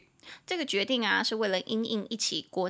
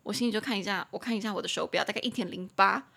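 usual speaking voice

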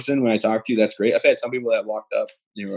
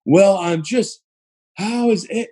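high-pitched mock concern; condescendingly loud; 9 semitones higher, much slower than usual. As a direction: imitate someone talking down to a patient. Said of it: "he kept talking loud".

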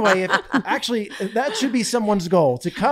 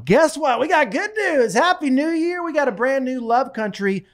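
excited; 8 semitones higher than usual. Real, not acted. Announcing good news excitedly.

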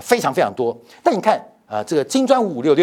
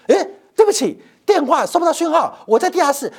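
high-pitched, louder fake apology; mock excuse; 11 semitones higher, 3 dB louder than usual. India dodging brother Pakistan's call, high mock excuse. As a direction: put on an evasive brush-off excuse voice.

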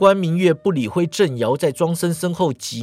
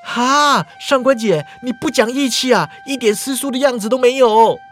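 higher, louder shocked protest; 7 semitones higher, 4 dB louder than usual. Acted. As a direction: cry out in disbelief at her betrayal. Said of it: "郑瑶大惊啊".

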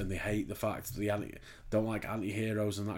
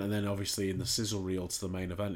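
ordinary talk, nothing marked

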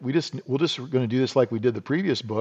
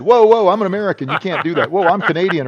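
high, loud, fast sissy whining; hammed-up panic, pleading to stop; mock panicked protest; 7 semitones higher, 7 dB louder, faster than usual. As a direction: squeal it out like a scared tourist. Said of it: "he could have been a sissy like I would have been and said".

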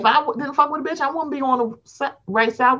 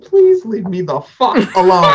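louder, laughing "leave me alone"; laughing plea; 4 semitones lower, 6 dB louder than usual. Retold or imitated. Host tells her cohost how she reacted, laughing and louder. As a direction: beg through laughter. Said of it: "I said".

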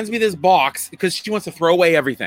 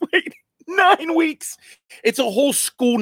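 much higher and slow, as it dawns on him; surprised realisation; 10 semitones higher than usual. Real, not acted. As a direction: stretch out the realisation, pitched way up. Realising the count is right.

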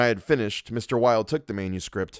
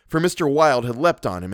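ordinary delivery, nothing marked

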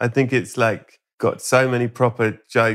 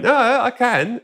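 mock insistence; 13 semitones higher, slower than usual. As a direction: imitate a show-off insisting, pitch jumping up. Acts out insistent boasting on date.

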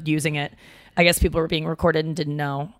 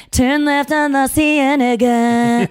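put-on slow, higher, crooned boy band GPS; 5 semitones higher, 4 dB louder, much slower than usual. Friend recalls a boy band GPS voice, crooned slow. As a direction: sing the directions like a boy band. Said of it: "like, boy band".

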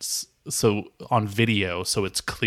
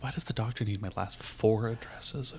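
muttering to self; 6 dB quieter than usual. Acted. Muttering while filling form.

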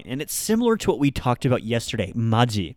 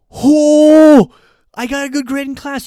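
much higher, bright excited friend voice; 13 semitones higher, 13 dB louder, slower than usual. Pretend. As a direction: squeal the good news like a proud student. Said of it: "it can be also something positive".

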